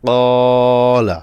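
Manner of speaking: slow teaching demo of a long vowel; stretched vowel demo. Shown as a demonstration. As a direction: draw out the কা in কলা and keep লা short. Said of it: "কফে টান হবে".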